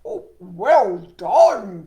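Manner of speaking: a big frog cheering loudly. Acted.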